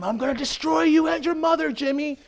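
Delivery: high, loud raging shout at Jimmy; furious threat. Acted. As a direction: scream the threat while lashing out. Beating roommate in rage.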